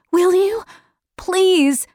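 put-on high child's begging; pleading girl. Meg begging to hear of her father, high pleading girl. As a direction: beg him in a high girl's voice.

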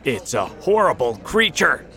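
shouted warning from a frightened crowd; alarmed shout. A narrator's reading. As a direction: cry out the alarm to everyone. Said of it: "started to shout".

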